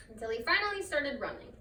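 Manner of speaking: ordinary delivery, nothing marked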